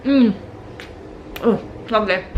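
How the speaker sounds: talking through a bite of cake; words round a mouthful; mouth full. Real, not acted. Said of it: "It's rude to speak with your mouth full".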